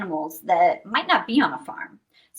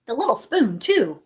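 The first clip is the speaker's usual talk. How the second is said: usual speaking voice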